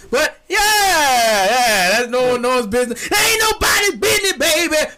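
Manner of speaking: shouting high and loud, hammed up; playful heartbroken shout; mock outburst. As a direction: yell it like a wounded diva. Mock outburst about his heartbreak.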